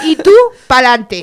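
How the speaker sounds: voz de Ayuso, más fuerte; imitando a Ayuso. Pretend. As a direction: mandona como Ayuso en campaña. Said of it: "el acento Ayuso". (imitación de Ayuso)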